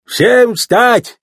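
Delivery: high, forceful courtroom order; shouted command. A narrator's reading. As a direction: call the whole room to its feet. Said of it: "крикнул шериф".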